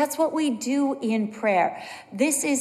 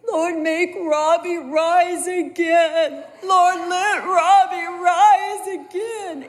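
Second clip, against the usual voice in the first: high, loud, drawn-out wailing prayer; imitated crying child; 7 semitones higher, 6 dB louder, slower than usual. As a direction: wail slowly like a pleading little kid. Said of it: "So then Char starts crying".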